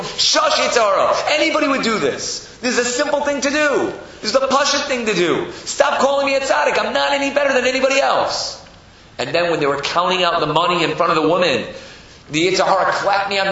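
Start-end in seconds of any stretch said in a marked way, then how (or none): 0.0-8.6 high, loud, insistent pleading; exasperated protest. Acted. Zusha shushing his inner tempter, exasperated. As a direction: beg the flatterer to stop.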